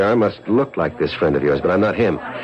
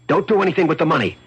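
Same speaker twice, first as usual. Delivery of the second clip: higher, firm and commanding; stern orders; 10 semitones higher than usual. Acted. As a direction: lay down the law to her. Man ordering his wife on phone.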